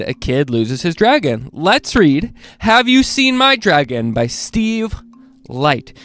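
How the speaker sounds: plain reading voice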